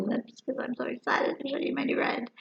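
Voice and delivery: whispered excitedly. Real, not acted. Whispering to viewers about her new red. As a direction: breathe it out in a whisper.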